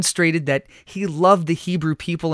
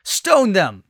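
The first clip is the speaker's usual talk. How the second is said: raised crowd cry, falling sharply in pitch; storybook yell, higher than narration; 4 semitones higher, 4 dB louder than usual. A narrator's reading. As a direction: call it out as an angry demand. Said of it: "someone yelled".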